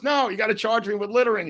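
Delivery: high, fast mock protest; rapid, high-pitched retold insistence; insistent refusal. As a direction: imitate someone stubbornly refusing to leave. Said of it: "he refuses to go".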